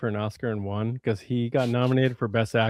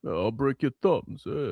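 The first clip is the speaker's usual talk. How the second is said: mock tough guy; 3 dB quieter than usual. Mock tough-guy Stallone line.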